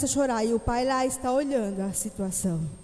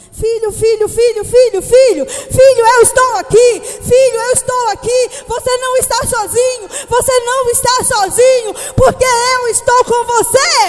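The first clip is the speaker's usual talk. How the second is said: much higher and louder, shouting and tearful; desperate father; 8 semitones higher, 12 dB louder, slower than usual. Acted. Father running to rescue his frightened son, shouting. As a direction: cry out to a scared child in anguish. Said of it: "o pai sai lá correndo desesperado".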